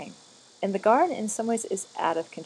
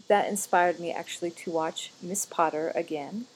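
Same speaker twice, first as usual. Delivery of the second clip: usual speaking voice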